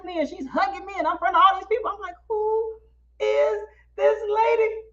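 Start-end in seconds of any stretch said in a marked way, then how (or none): 2.3-4.8 high, slow, spaced-out question; mock baffled aside, drawing out "who"; bewildered. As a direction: stretch it out in retold bewilderment. Bewildered at stranger's hug, retold.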